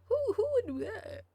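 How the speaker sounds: appalled squeal at the screen; incredulous outburst. Real, not acted. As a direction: blurt it out, scandalised. Reacting to cutscene line on marriage.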